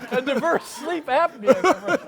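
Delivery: laughing through it, pitch jumping up; laughing repeat. Real, not acted. Host cracks up repeating the guest's joke, laughing.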